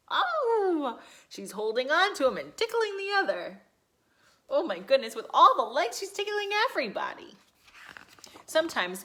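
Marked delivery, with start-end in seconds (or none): 0.1-7.3 high, loud giggly delight; excited. Acted. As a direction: gush over the tickling. Excited about tickling octopus.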